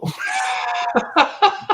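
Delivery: high, loud laughing shout; loud burst of laughter. Real, not acted.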